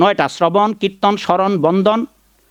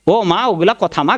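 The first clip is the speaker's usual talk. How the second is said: usual speaking voice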